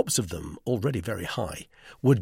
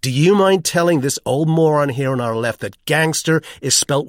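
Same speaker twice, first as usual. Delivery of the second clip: loud, indignant American tirade; sharp-tongued, emphatic setting straight; acerbic; 3 semitones higher, 7 dB louder than usual. A narrator's reading. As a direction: reel off the complaints with tart sarcasm. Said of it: "he said with some acerbity".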